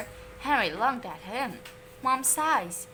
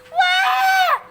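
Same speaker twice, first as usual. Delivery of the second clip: much higher, loud wailing cry; 12 dB louder than usual. A narrator's reading. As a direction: wail like a hurt child. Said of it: "wailed William".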